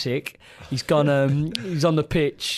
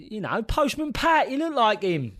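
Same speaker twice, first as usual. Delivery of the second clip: mock innocent teammate, pitched up; cheeky reply; 8 semitones higher than usual. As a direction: tease the boss to his face. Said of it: "He says".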